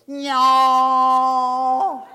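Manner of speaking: loud, high held bagpipe blare; mock bagpipe squeal; bagpipe drone. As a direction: imitate bagpipes starting up with a long nasal wail. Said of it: "the bagpiper goes".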